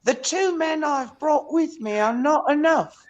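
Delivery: high, slow put-on British accent; mock British sweep. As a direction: draw it out like a formal British tradesman. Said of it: "He said, then there's gonna come back to you".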